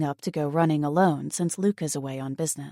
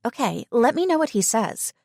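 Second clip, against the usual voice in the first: friend's voice; 6 semitones higher, 4 dB louder than usual. Acted. Voicing friend Tegan.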